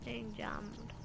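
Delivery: soft, resigned repeat; quiet echo. Real, not acted. Gamer repeating the bad news to self, quietly. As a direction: say it softly to oneself.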